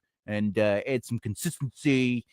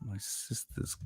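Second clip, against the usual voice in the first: whispered; 3 semitones lower, 17 dB quieter than usual. Real, not acted. Whispering words while typing.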